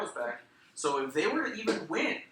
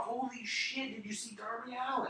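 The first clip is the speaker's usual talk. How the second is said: mock amazement; 11 semitones higher than usual. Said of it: "everyone was talking about".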